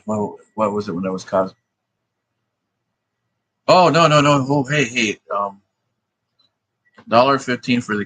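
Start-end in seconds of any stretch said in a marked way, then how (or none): none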